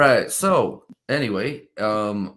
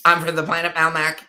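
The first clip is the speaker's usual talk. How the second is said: Alf voice; 6 semitones higher than usual. Retold or imitated. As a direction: play the cheerful alien Alf. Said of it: "But it's like".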